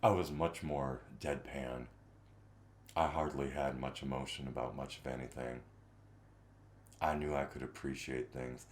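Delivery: put-on low, soft, flat monotone of his old self; deadpan voice. Streamer imitating his own former voice to chat, low deadpan. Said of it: "what was the voice?".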